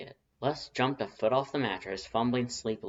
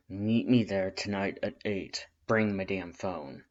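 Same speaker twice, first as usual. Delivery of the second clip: gruff voice; 4 dB louder, slower than usual. Acted. Gruff caller giving orders.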